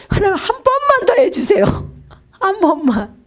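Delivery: high, crying plea to God; tearful pleading. Retold or imitated. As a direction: beg through tears, voice breaking high. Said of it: "울다가". (울면서 하나님께 한 번만 더 애원하는 목소리)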